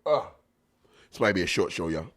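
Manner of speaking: ordinary talk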